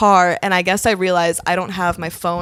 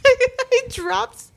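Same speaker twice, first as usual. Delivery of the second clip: ordinary delivery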